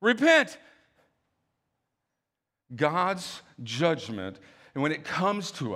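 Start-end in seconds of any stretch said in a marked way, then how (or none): 0.0-0.6 loud, high urgent plea; earnest loud call to repent; pleading god. Retold or imitated. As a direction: beg them to turn back, pressing hard. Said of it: "God's like".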